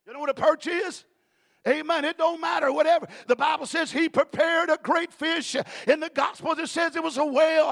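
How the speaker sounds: loud, high preaching; full-force preaching. Acted. Preacher thundering at the church, loud and high. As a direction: thunder it to the congregation.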